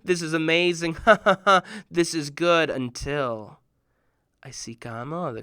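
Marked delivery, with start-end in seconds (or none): 0.0-2.7 reading and laughing aloud. Retold or imitated. Boy laughing while reading his new books. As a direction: chuckle with delight. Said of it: "I'm like".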